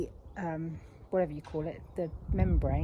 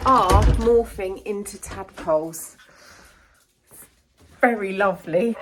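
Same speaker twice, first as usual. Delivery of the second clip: much louder, thrilled announcement; 9 dB louder than usual. Real, not acted. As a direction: announce it with real delight. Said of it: "I'm absolutely thrilled to report".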